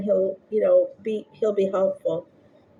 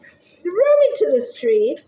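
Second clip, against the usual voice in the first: plain reading voice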